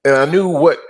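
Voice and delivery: normal talking voice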